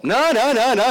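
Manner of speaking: high, loud no no no; hammed-up Corinthians begging him to stop; mock protest. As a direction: shout him down in jest. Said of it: "the Corinthians are like".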